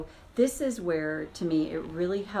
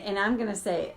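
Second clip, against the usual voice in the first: ordinary talk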